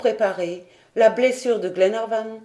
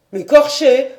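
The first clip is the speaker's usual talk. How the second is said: loud, higher outraged cry; furious; 6 semitones higher, 10 dB louder than usual. Acted. Paganel furious at the major's joke. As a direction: fling the word back in fury. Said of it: "d'un air furibond".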